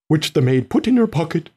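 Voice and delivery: ordinary delivery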